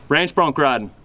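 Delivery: usual speaking voice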